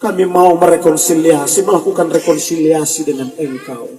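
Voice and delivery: lightly voiced show-off praying in big words; mock lofty prayer. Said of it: "pakai bahasa tinggi".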